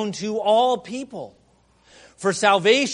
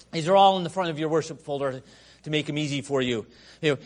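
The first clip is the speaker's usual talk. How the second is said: normal talking voice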